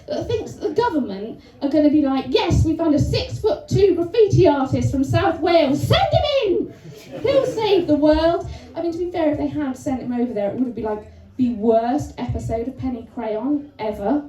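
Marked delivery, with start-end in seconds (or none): 2.3-8.5 high rallying cry on the order; mock triumphant announcement. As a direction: declare it like a heroic rescue plan. Government's imagined heroic announcement.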